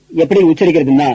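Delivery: usual speaking voice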